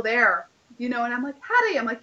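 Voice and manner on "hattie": calling out. Retold or imitated. Calling up from the river to Hattie on the bridge. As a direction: call her name up from the river.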